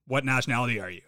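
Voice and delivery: ordinary talk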